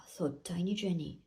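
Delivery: usual speaking voice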